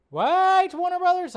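high, loud disbelief at the studio; exasperated call-out. Real, not acted. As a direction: yell it up at Warner Brothers.

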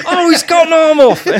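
high squeal of laughter through the line; movie line gasped out laughing; laughing quote. Retold or imitated. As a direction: squeal the quote out while cracking up. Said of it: "so many quotes from that movie".

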